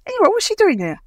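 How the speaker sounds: pry like a chatty coworker; colleague voiced. Coworker with cake asking why she came, mock high voice.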